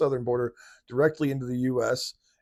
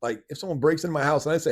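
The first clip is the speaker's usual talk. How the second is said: ordinary talk, nothing marked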